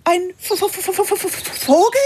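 stammering in fear; can't get the word out for fright; nervous stutter. Acted. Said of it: "Elli ist so nervös".

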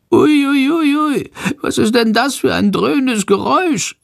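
high, jittery bat voice; high and anxious, a scared bat; fearful squeak. Acted. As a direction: squeal it like a frightened little bat. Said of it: "fragt er ängstlich".

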